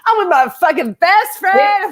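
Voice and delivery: high, gushy mock best-friend voice; sing-song mimicry of a clingy friend; mock gushing. Mocking a cast member's gushing, high and sing-song.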